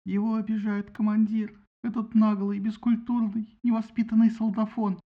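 высоко и громко, с обидой; наябедничай громко и звонко; возмущённая жалоба. A narrator's reading. Фетида жалуется на обидчика. (высокая возмущённая жалоба)

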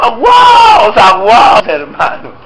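mock scolding shout. Real, not acted. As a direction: tease the cowards in the pews. Mocking scold, voice shifts.

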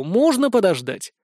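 ordinary delivery, nothing marked

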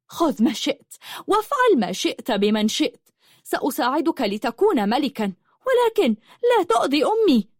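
high voice climbing to a cry; impassioned plea. A narrator's reading. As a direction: plead fiercely, rising to protect mother. Daughter pleading to protect her mother. (توسّل محتدّ يعلو إلى صرخة)

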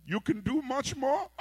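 hammed-up disbelieving uncle; mock surprised uncle. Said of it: "And the uncle said".

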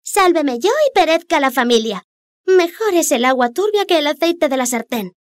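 high, cheeky little fish. Acted. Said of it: "como si quisiera decir".

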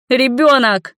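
high, loud possessive cry; desperate shout. Acted. Mother fights her lover's grip, desperate shout. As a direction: scream it while struggling.

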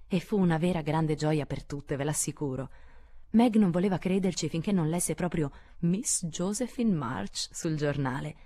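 ordinary delivery, nothing marked

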